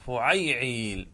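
فعيعيل drawn out slowly, each syllable clear; slow demo of فعيعيل. Shown as a demonstration. Teacher to students, فعيعيل spelled out slowly. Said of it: "على وزن فعيعيل".

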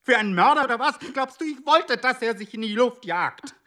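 high, loud, outraged yelling; furious shout. Acted. Husband shouts at his silent wife over the dead boy, enraged. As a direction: hurl the questions at her, stung and indignant.

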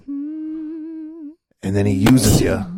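sudden high squeak, slow; squeaky high voice. Acted. Hammed-up tragic story.

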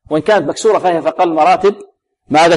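ordinary delivery

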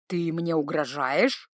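troll is taken aback; surprised troll. Acted. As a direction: ask in disbelief. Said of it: "удивился он".

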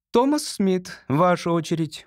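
high-pitched, coolly official summons; cold. A narrator's reading. Secretary calling the next examinee, coldly. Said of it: "холодно сказала секретарша".

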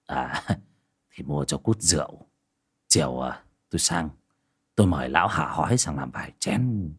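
put-on old man Quyền answering his wife, low and unhurried. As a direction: play the weary old village husband, low and quiet. Old husband Quyền voiced.

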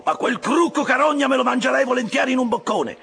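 high-pitched, exasperated yelling at the crew; goading shout. A narrator's reading. Officer egging on his rowers in a chase, high loud shouting. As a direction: goad the rowers at the top of your voice. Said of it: "gridava intanto Stabbe al suo equipaggio".

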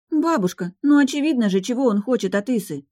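high, exasperated protest, easing down; indignant. A narrator's reading. Granddaughter protesting to grandmother about Isa's suitor, indignant. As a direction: object as if it's obvious, pitch well up. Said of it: "возмутилась Линара".

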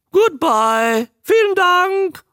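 very high, loud croak; a trained newt bidding farewell in several languages. Acted. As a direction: croak your goodbyes.

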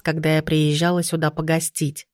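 ordinary delivery, nothing marked

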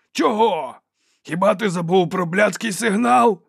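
high, loud indignant questions; angry yelling. A narrator's reading. Fighters quarrel over the forgotten signal, yelling. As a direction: demand an answer at a shout. Chief and Dow quarrel after battle.

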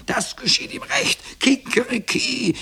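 squawky, extremely high rooster voice; crowing rooster. A narrator's reading. Rooster gloating over the boy's fate, crowing. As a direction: crow the words like a cockerel. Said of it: "krähte der Hahn".

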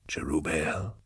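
low, very soft whisper. A narrator's reading. Eisenhorn summons something from the rain above, whispering. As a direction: whisper the name into the dark. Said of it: "he whispered".

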